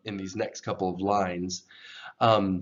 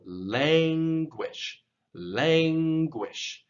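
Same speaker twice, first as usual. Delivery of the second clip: "languish" with LANG stretched long and raised high; stress on LANG; 6 semitones higher, much slower than usual. Shown as a demonstration. Teacher demonstrates English emphasis, elongated raised first syllable. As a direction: hold a high "lang", then drop on "-uish" in "languish". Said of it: "elongate it and raise the tone".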